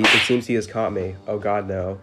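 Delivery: ordinary talk, nothing marked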